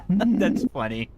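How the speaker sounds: laughing while talking, slow. Real, not acted. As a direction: giggle through the words. Laughing at the reflection.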